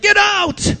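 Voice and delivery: high, loud shouted command; Pharaoh shouting. Retold or imitated. Pharaoh ordering the Israelites out, high loud shout. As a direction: bark it out like an angry king. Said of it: "Pharaoh's like".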